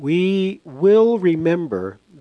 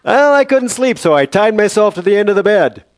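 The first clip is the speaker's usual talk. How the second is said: much higher, louder self-justifying voice; hammed-up high-pitched excuse; mock delirious explanation; 8 semitones higher, 6 dB louder than usual. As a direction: explain yourself in a high, loud comic voice. Acts himself answering the nurse.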